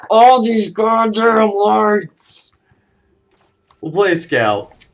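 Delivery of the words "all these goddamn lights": slow, high, loud drawn-out groan of a complaint; exasperated. Real, not acted. Owner grumbling to his dog about the lights, slow and drawn out. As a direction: drag every word out in mock despair.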